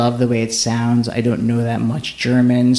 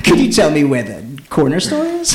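higher, theatrical sing-song with a laugh; exaggerated German-style manner; 4 semitones higher than usual. Asking a woman on the street for directions, hammed-up high manner. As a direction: overplay the polite question, swooping up and down. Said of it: "in a manner I'd never say it in English".

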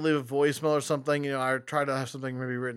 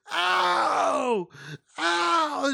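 mock yelp; 11 semitones higher than usual. Preteen kid hammed-up yelping at old man. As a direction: overplay a whiny kid's hurt yelp. Kid yelps when foot tripped.

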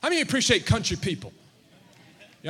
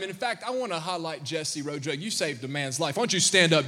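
ordinary talk, nothing marked

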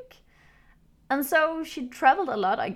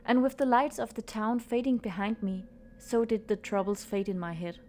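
story narration. A narrator's reading. Reads the story over music.

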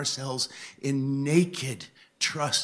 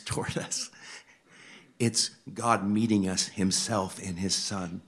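ordinary talk, nothing marked

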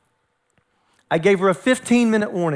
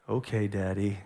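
low, soft, reluctant surrender; resigned child; 11 semitones lower, 10 dB quieter than usual. Retold or imitated. Daughter finally obeying dad, low and soft resignation. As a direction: give in quietly, grudgingly.

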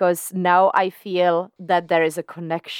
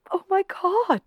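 excited squeal. Real, not acted. Gushing over favourite actors together.

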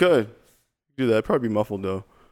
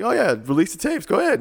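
mocking Comey; 8 semitones higher than usual. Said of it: "he's like".

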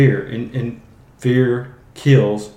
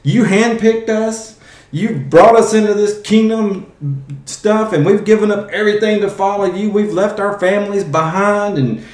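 angry complaint; 8 semitones higher, 4 dB louder than usual. Retold or imitated. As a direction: rail at him like a betrayed follower. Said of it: "they're mad at Jesus here".

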